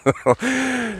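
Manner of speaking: squeaky fit of giggles; high helpless giggle. Real, not acted. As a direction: giggle uncontrollably. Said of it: "Just had the giggles".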